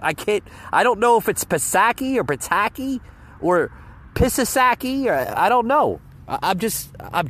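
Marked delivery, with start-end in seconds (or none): 0.0-5.1 trying Psaki, then Pataki, then Pisasaki, each "puh-SAH-kee", "puh-TAH-kee", "pee-sah-SAH-kee", rising to a higher Pisasaki; unsure guesses at Psaki, Pataki and Pisasaki; fumbling name guesses. Shown as a demonstration. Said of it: "I don't wanna say her name wrong".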